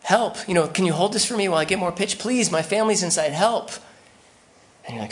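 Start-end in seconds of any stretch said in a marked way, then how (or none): 0.0-3.7 high, loud, desperate cry for help; high and loud, pleading with the neighbor to help; panicked yell. Pretend. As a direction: shout for help with your family trapped inside. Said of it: "the neighbor yells".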